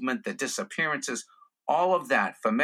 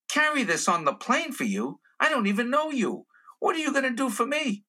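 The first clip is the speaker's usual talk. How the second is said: climbing higher in comic disbelief; pitched-up indignant haggling; mock incredulous; 11 semitones higher than usual. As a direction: balk at a stranger's cheek, acted. Acted reply to stranger's request.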